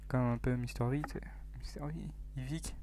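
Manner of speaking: conspiratorial whisper; whispered aside. Acted. Gamer drops a sly whispered reference to viewers. Aside comparing himself to youtuber.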